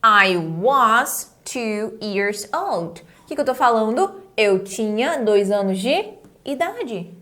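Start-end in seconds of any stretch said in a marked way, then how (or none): 0.0-3.0 put-on I was two years old said very slowly and clearly; teacher spelling out the sentence slowly; slow model sentence for the students; slow demo. Shown as a demonstration. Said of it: "eu falo como".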